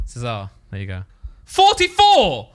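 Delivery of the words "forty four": high, drawn-out loud shout. Real, not acted. Man blurting out a number mid-chat, shouting. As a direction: bellow it out, stretching the word. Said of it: "see you shouting that".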